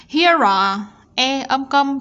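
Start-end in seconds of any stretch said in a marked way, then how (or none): 0.1-0.8 loud HERE ARE linked through the R. Shown as a demonstration. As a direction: run "Here are" together as one word, loudly. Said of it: "chúng ta sẽ nối âm chữ R".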